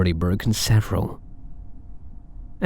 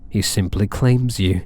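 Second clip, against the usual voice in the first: ordinary talk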